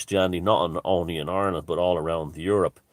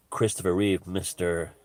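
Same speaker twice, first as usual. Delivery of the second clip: usual speaking voice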